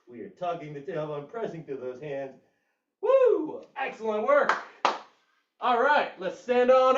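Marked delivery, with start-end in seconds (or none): 3.0-3.6 high, loud whoop of joy; excited whoop. Real, not acted. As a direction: let out a big cheer. Hype cheer in workout.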